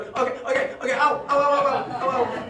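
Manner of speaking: imitating loud, high cries of pain; mock pain yelps. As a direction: yelp frantically as if tapping out, playing it for laughs. Imitating the man in an armlock.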